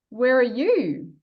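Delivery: normal talking voice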